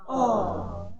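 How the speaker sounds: falling "Oh" of disappointment; disappointed "oh". Shown as a demonstration. Group exercise saying O to mean disappointment, disappointed. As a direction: let "Oh" sink, let down.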